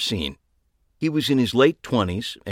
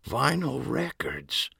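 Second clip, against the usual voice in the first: soft, slow and reverent; hushed reverent; 5 semitones higher, 6 dB quieter, slower than usual. A narrator's reading. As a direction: breathe it like a prayer. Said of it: "in a hushed, almost religious tone".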